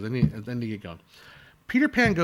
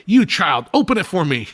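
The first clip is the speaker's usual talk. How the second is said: louder, higher haughty villain command; Captain Hook; 4 semitones higher, 6 dB louder than usual. Retold or imitated. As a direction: order the child about like a pompous pirate. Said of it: "He's like".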